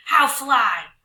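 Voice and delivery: loud troll question; troll roar. Acted. Trolls demand how, loud. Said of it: "the trolls roared".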